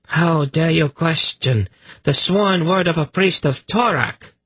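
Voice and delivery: high-pitched indignant challenge; angry. A narrator's reading. Priestess defending a priest's sworn word, angry. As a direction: rebuke the doubter angrily. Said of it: "Kabat said angrily".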